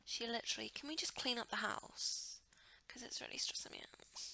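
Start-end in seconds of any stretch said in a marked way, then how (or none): 2.9-4.2 stressed whispered aside. Real, not acted. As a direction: whisper it like a confession.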